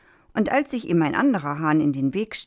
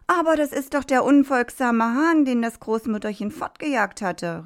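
calling out; 7 semitones higher than usual. A narrator's reading. Farmer corrects the people about the rooster, calling out. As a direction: call it over with raised pitch. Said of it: "rief der Bauer den Leuten zu".

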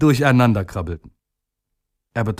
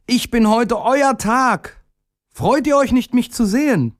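little day speaking; 12 semitones higher than usual. A narrator's reading. Little day asking the people to welcome him, high and hopeful. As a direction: ask them high and hopefully.

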